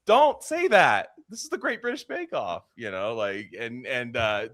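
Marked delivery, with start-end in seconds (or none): 0.1-2.7 high, loud outburst, slowing into a scold; hammed-up high-pitched dismay; mock horrified show editors. Podcaster imagining editors who must cut that out, high mock horror. Voicing producers reacting on the show.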